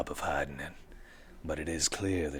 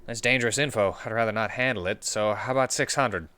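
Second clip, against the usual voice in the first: character voice; 10 semitones higher, 8 dB louder than usual. Acted. Haggling NPC voices.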